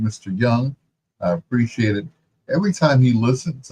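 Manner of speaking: ordinary talk, nothing marked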